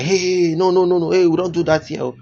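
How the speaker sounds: loud, high burst of protest; alarmed scolding. Retold or imitated. Bus passengers to a litterer, alarmed scolding. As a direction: call out in rapid, repeated objection.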